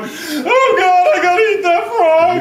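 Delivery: high, loud dreading whine; hammed-up disgust. Audience member dreading the frog, high mock whine. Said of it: "they're gonna be going".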